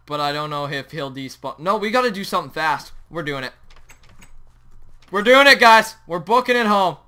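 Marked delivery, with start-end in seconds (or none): 5.1-7.0 loud, high announcement; rally the viewers loudly; fired-up declaration; rallying shout. Real, not acted. Announces the decision with force.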